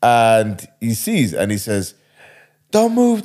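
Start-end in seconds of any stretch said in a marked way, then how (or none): 2.7-3.2 sharp high command to freeze; police shout. Retold or imitated. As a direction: bark it like a cop with a gun drawn.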